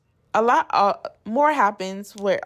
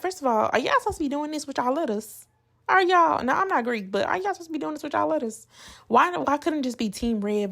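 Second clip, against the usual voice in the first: incredulous; 7 semitones higher, faster than usual. Retold or imitated. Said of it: "I was just like".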